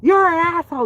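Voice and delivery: high, loud mock outraged yell; imagined angry viewer. Offended viewer in the chat scolding Frank, mock yell. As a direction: squawk it like an offended heckler. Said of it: "There's gonna be somebody in there".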